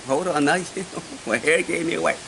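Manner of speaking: mock-indignant, laughing repeat; amused disbelief. Laughing disbelief at puzzling answer.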